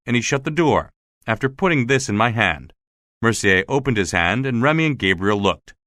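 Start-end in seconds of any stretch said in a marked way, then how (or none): none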